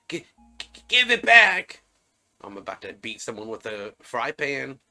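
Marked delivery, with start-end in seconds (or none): 0.1-1.8 stuttering start, then a loud high yell; stammer, then burst out shouting. Acted. Game character demands their thing back, stammered shout.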